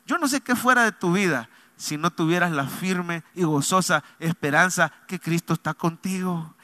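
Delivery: ordinary talk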